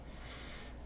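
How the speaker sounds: S as a soft unvoiced hiss; just hiss the S sound. Shown as a demonstration. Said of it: "I also hear the".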